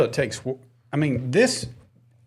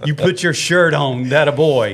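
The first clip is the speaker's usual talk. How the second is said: slow, higher, hammed-up praise for a little kid; mock dad praise; 4 semitones higher, 4 dB louder, slower than usual. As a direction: overdo the praise like a proud dad, laughing. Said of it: "mock praise to son".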